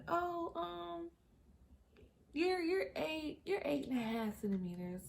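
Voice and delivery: high, soft, slow hesitant nurse voice; imitated nurse. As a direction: break it to the patient gently, drawn out. Nurse reporting after checking her.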